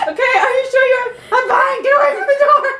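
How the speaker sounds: high loud shouts cracking with laughter; laugh-cry shouting match; frantic shouting. Retold or imitated. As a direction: shout through the door, desperate. Frantic shouting through the door.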